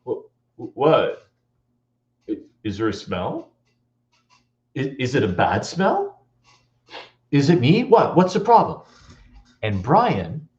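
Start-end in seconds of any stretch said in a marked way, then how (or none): 0.0-8.8 hesitant stammer, rising into worry; imitating an anxious kid brother; younger brother feigning cool. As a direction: start small and unsure, grow more urgent. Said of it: "trying to be cool with his brother".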